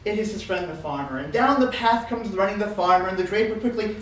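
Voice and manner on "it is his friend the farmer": ordinary delivery, nothing marked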